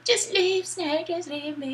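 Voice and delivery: high-pitched mock damsel plea; put-on high voice, begging; wounded plea. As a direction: plead like a hammed-up wounded heroine. Voicing wounded Meryl lying on floor.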